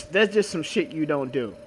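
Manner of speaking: ordinary talk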